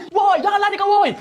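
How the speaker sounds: another high, loud shout after the runner; yelling after thief. Acted. Same villager still chasing the thief, shouting again. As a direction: keep bellowing at him to stop.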